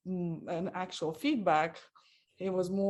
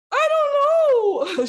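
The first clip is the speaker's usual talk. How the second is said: very high, loud, drawn-out whine; whiny child; 13 dB louder, much slower than usual. Retold or imitated. Mother imitating her daughter refusing to answer, slow high whine. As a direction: stretch it out like a sulking kid.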